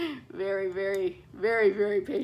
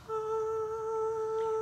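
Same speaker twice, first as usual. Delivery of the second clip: relieved sigh; 14 semitones higher, 4 dB quieter than usual. Retold or imitated. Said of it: "I was just like".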